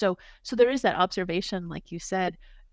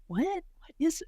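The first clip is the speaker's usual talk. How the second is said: mock bafflement; 10 semitones higher than usual. Doctor recalling surprise at being recognized, mock bafflement. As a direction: replay being taken aback, pitch jumping up. Retelling own puzzled reaction to award.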